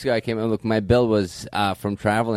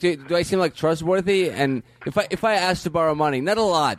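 needy service rep; 7 semitones higher than usual. Acted. Plays needy service rep on phone.

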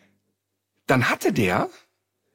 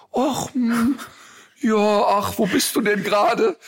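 high, whimpering, evasive voice of a scared friend; panicky whimper; 8 semitones higher than usual. Retold or imitated. Friend on a sinking boat stalling, high and whimpering.

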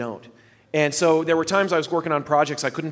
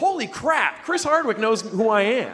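excited; 5 semitones higher than usual. Retold or imitated. Retold thrilled inner reaction.

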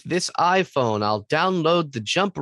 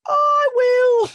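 very high, loud eager squeak; squeaky eager voice; 6 dB louder than usual. Pretend. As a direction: squeal it like a cartoon kid.

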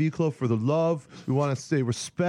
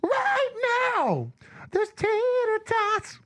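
very high, strained silly voice; hammed-up, pitched way up; put-on character voice; much slower than usual. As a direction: squeal it out slowly in a put-on voice. Impersonating loud TV personality.

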